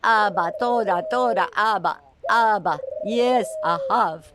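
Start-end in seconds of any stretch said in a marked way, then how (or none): none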